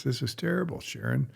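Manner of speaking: high-pitched mock indignation to his wife; exasperated complaint. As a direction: whine it in protest, pitch jumping up.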